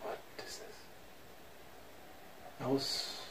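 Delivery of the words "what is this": barely audible whispered question. Real, not acted. Player puzzling over the game, whispering. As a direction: whisper it to yourself.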